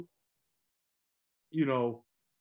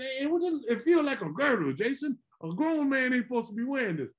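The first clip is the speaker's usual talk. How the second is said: complaining dad voice; 7 semitones higher than usual. Retold or imitated. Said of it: "he used to say".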